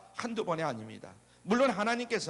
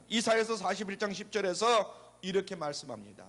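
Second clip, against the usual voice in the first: ordinary talk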